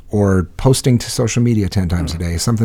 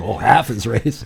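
mocking echo; slower than usual. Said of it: "Oh, now listen to you".

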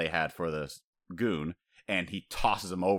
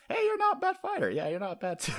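playful rival praise. Retold or imitated. Voicing Luffy and Shiraya.

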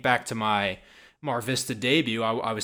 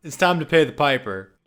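mock-ominous announcement; 4 semitones higher, 5 dB louder, much slower than usual. Real, not acted.

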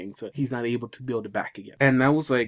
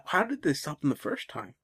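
normal talking voice

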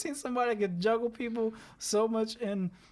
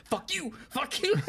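mock angry curse; 8 semitones higher than usual.

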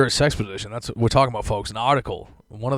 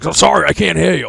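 loud, higher mock-apology over the phone; irritated phone shout; 6 semitones higher, 10 dB louder than usual. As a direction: imitate a dad talking over the noise, pointedly. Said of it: "he's like".

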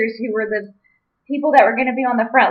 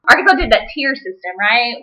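ordinary talk

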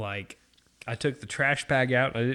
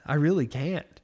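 ordinary talk, nothing marked

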